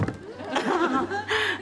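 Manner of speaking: high, breathy giggle; giggle, scandalised. Real, not acted. Giggles at the caller's suggestion.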